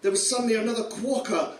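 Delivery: plain reading voice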